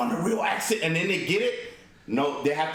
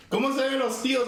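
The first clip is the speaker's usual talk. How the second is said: Mexican Spanish; 8 semitones higher, faster than usual. Retold or imitated. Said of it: "'Cause you hear".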